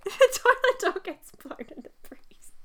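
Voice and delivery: high, breathy laughing repeat; laughing whisper. Real, not acted. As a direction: giggle it under breath. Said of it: "I love that".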